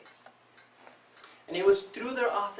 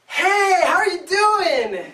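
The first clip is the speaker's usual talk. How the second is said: much higher and much louder, sing-song and slow; overly cheery greeting; 11 semitones higher, 13 dB louder, slower than usual. Retold or imitated. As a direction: beam it out like an eager, bubbly acquaintance. Cheery greeter asking how you are.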